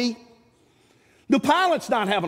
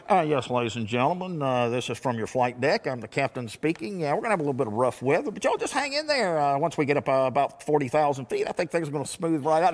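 lower, drawling captain announcement; pilot on the intercom; 5 semitones lower than usual. Retold or imitated. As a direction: imitate a laid-back airline captain. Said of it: "the pilot comes on and he's like".

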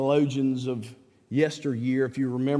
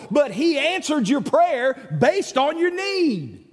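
higher, louder, preaching the point to a friend; wise brother preaching; 11 semitones higher, 5 dB louder than usual. Retold or imitated. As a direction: drive the lesson home like a pulpit voice. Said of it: "He said".

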